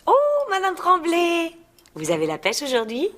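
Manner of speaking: high, effusive mock-Parisian French; gushing phone manner. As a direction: flatter a client in a fake Paris accent.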